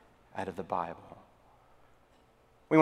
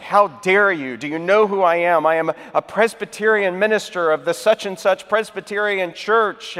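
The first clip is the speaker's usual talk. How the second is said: higher, louder mock outrage; indignant; 4 semitones higher, 3 dB louder than usual. Presbyterian pastor protests to Saint Peter, acted indignant. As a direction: pull rank in hammed-up indignation. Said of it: "pastor is indignant. He's like".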